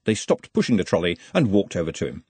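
plain reading voice

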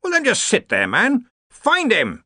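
impatient order. Acted. Ridcully orders the computer.

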